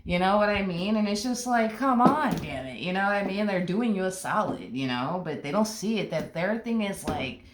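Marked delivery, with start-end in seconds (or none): none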